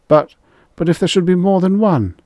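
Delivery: high, loud, stammering panic; panicked stammer. Acted. Rye pleads with Extroni, high and panicked. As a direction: blurt it in terror.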